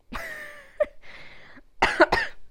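embarrassed giggle; laughing. Real, not acted. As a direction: laugh at losing your thought. Laughs after losing thought.

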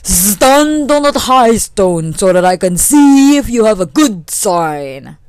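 loud, slow, drawn-out order; booming, stretched-out voice; commanding thunder. Acted. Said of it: "Then the thunder commanded".

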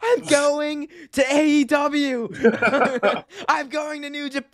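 mock promo outburst, high and drawn out; slow, high-pitched wrestler threat on the mic; hammed-up angry promo voice, breaking into laughter; Charlotte Flair. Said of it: "could you imagine Charlotte Flair, like, on a mic".

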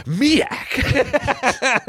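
high-pitched eureka, sounding out the made-up word; Ernest. Acted. Ernest misreads the riddle for milk, high mock eureka. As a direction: blurt it high, like a dim hero's discovery. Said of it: "Ernest saw that and he said".

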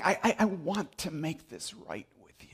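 stammering unvoiced plea; whisper. Pastor voicing a sinner pleading with someone, whispered.

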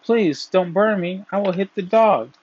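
high, urgent storybook plea; pleading cry. A narrator's reading. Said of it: "the stick in turn cried out".

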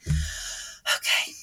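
hushed, breathy self-pep talk; soft unvoiced muttered resolve; whispered self-talk. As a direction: whisper it to yourself like a tired mom at dawn.

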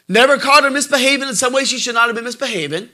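normal talking voice

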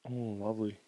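ordinary talk

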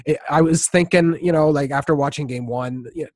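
ordinary delivery

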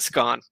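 "scone" said like "skon"; British short-o pronunciation. Shown as a demonstration. Podcaster telling co-hosts the UK pronunciation, short-o scone. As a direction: rhyme "scone" with gone, open vowel. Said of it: "They would pronounce it scone".

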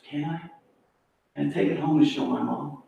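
very soft, tentative question; timid request. Retold or imitated. Agnes asks Harry about the cake, imitated timid soft voice. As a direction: imitate asking permission almost under the breath.

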